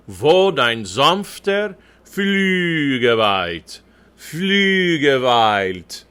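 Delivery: "Wo dein sanfter Flügel weilt" very drawn out; slow diction model. Shown as a demonstration. Coach modelling for the choir, very slow German diction. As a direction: stretch the ü of "Flügel" and each syllable. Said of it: "All those L's in there".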